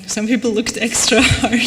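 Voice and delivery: breaking into a laugh mid-sentence; amused laughing. Real, not acted. Speaker jokes to audience about the beach search, amused laughing. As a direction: let real laughter take over the last word.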